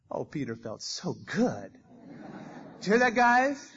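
self-satisfied boasting to the crowd; smug gloating. Acted. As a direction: brag like a proud kid. Peter gloating over compliment.

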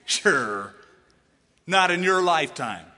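loud, scoffing "oh yeah, right"; mock the good news as impossible; hammed-up sarcastic scoff; sarcastic disbelief. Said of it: "you'd probably go".

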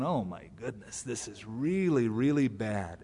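low, soft, slow worry; hushed dismay. Acted. Gideon left with ten thousand men, quietly dismayed. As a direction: mutter it slowly to yourself in dismay.

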